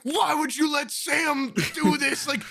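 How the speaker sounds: imitating the dad shouting about the broken plane; mock furious dad yell. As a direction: blast the kids like an outraged father. Angry dad yelling at kids.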